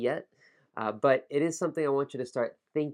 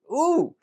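high, loud "ooh!"; exclaimed "ooh"; 14 semitones higher, 8 dB louder than usual. Real, not acted. Teacher tripping on a tongue twister, exclaiming. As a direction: let out a loud high whoop.

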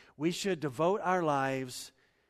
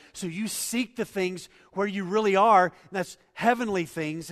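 louder, higher preacher's voice, building; rising preaching; 6 semitones higher, 11 dB louder than usual. Acted. Pastor urging the church to seek heavenly things, fervent preaching. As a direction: drive the point home from the pulpit.

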